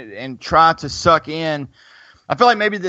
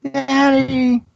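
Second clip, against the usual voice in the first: through a vent; 12 semitones higher, 4 dB louder, much slower than usual. Real, not acted. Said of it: "talking through the vents at me".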